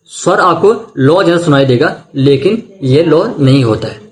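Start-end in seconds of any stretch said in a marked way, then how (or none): none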